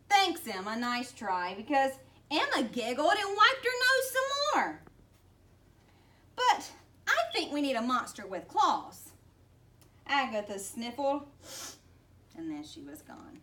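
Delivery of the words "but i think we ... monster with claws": put-on higher, louder kid voice; little girl voice. Child voiced.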